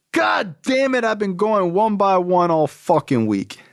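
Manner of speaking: high-pitched mock exasperation, stretched out slowly; exasperated outburst. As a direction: imitate dad blowing up, pitch leaping high then easing down.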